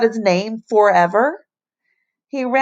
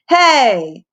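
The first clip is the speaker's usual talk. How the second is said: calling after; 5 semitones higher, 6 dB louder than usual. A narrator's reading. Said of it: "Ham called after him".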